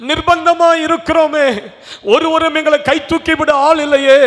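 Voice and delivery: high, loud, desperate lament; anguished wailing. Acted. Israelites in Egypt lament their bondage, loud wailing. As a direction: wail out the misery of slaves.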